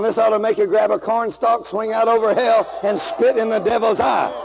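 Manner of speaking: usual speaking voice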